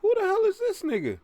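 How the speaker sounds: very high, loud falsetto; shocked falsetto. Acted. Reacting to a voice on screen.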